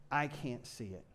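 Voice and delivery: low, quiet mopey whine; whiny Thomas. Acted. Thomas moping to Jesus, low quiet whine. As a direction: mope it out defeated. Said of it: "he's like".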